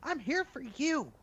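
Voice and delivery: high-pitched protest; high, embarrassed reassurance; flustered denial. Acted. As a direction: protest in a squeaky, pleading rush that slows at the end. Flustered denial of capturing children.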